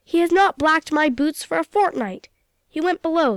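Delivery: a touch of captain's temper; losing his temper. Acted. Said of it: "losing his temper".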